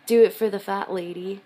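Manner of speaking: loud, emphatic. Real, not acted. Quotes her wrist tattoo.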